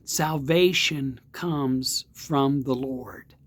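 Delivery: slow, weighty delivery; slow emphasis. Shown as a demonstration. Preacher quotes Jonah to his listeners, slow and weighty. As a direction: lay each word down slowly. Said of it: "now listen to this".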